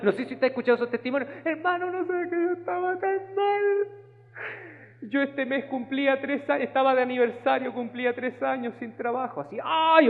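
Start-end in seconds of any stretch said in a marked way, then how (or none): 1.4-9.4 much higher, wailing churchgoer; mocking testimony voice. Preacher mocks a churchgoer's dramatic testimony, high and whiny. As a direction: parody a self-pitying testimony. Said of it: "Porque llegaban unos testimonios".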